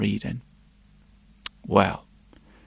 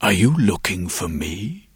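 dragon voice; 7 semitones lower, 3 dB quieter than usual. Acted. Dragon speaking to the girl.

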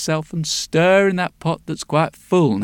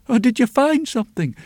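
lightly voiced old woman, a little higher; old woman's voice; 7 semitones higher than usual. A narrator's reading. Old woman greets the returning witch, lightly voiced. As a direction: ask it as a hopeful old woman. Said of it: "said the old woman".

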